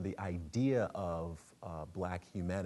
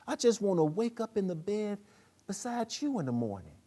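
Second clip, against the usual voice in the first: much higher, louder pleading woman's voice; Tanya; 9 semitones higher, 4 dB louder than usual. Acted. Tanya begging King to stay for the baby, high and pleading. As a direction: plead with him to just be a father.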